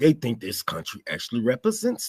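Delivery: sneering rich onlooker. Pretend. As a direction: look down from the balcony and sneer. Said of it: "They're looking at you like".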